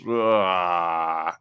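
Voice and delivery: low, soft groan of disgust; a fed-up "ugh" under the breath; mock disgusted ugh. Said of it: "the thinking person kind of goes".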